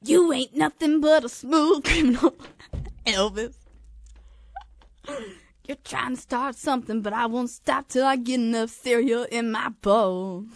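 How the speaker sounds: lightly voiced pop star impression. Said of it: "can I be [name]?".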